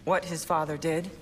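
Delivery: usual speaking voice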